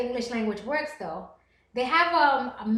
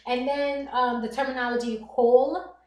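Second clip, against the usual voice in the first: ordinary talk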